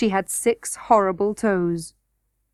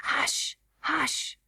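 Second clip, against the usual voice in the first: soft, breathy hushing; urgent hush; 5 semitones higher, 8 dB quieter than usual. A narrator's reading. Curdie hushing Irene so they aren't heard, quiet. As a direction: hush her under your breath.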